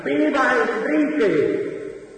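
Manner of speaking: high, slow triumphant cheer; jubilant cry. Acted. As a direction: ring it out like a victorious crowd. Victors shouting for Enrique.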